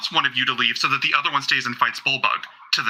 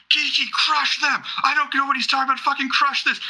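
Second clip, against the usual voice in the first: high, strained spectator yell; excited high-pitched heckling; rival fan cheer; 12 semitones higher than usual. Acted. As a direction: egg your fighter on from the crowd. Rival fans shouting encouragement.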